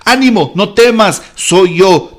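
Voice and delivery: loud, high, full-force preaching; a preacher booming Jesus' words. Acted. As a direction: proclaim it to the congregation. Preacher proclaims the verse.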